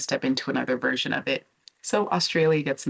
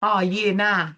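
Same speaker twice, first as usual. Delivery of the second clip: higher-pitched, badly faked Aussie accent; Australian accent; 5 semitones higher, 4 dB louder than usual. Vlogger imitating Australian men before giving up, mock Australian accent. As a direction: play up the Australian vowels for a joke. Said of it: "I'm just not gonna do accents in this video".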